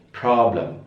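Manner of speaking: British 'problem' with rounded o; British pronunciation. Shown as a demonstration. Teacher contrasts accents for students, British pronunciation. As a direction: round the first vowel of 'problem', British style. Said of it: "Kalau British".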